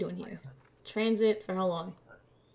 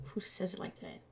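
whispered aside; 8 dB quieter, faster than usual. Real, not acted. Disgusted aside at document.

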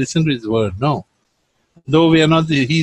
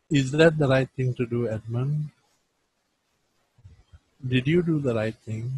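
soft, gentle asking, much softer; gentle questioning; 3 semitones lower, 6 dB quieter than usual. Retold or imitated. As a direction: ask softly, like a kind father not scolding. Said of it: "he will ask in this form".

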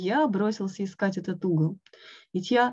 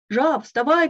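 loud, higher call at the door; 6 semitones higher, 6 dB louder than usual. A narrator's reading. Frog calling Toad through the door, loud. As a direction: shout through the door to wake him. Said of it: "кричал он".

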